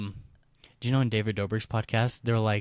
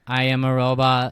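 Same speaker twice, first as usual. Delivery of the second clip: louder flat robot voice; 7 dB louder than usual. Retold or imitated. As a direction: imitate a robot, even and stiff. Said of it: "That's what Emma used to do".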